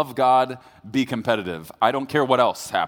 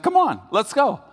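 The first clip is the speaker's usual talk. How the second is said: much higher teasing goad; mock taunting wife; 11 semitones higher, 5 dB louder than usual. Husband imitating wife pushing him to fight, high teasing goad. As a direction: egg him on playfully. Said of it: "She's like".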